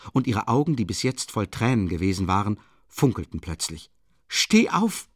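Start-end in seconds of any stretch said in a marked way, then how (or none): 4.3-5.1 loud, high-pitched order; urgent command. A narrator's reading. Sonja ordering him to his feet, urgent command.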